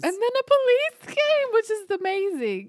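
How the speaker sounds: high, loud, thrilled echo; mock excitement. Real, not acted. Echoing her friend, a high thrilled squeal. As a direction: squeal the news back in delight.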